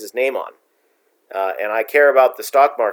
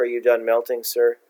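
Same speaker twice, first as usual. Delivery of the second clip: deadpan; 2 semitones lower, 4 dB quieter than usual. Pretend. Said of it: "just totally deadpan and serious".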